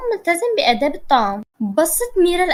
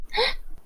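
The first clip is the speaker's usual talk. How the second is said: sharp breathless gasp of fear; frightened gasp; 9 semitones higher, 9 dB quieter than usual. A narrator's reading. Nour gasps in fright at her uncle, a sharp gasp. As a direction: gasp in sudden terror. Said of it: "نور بخوف وهي بترتجف".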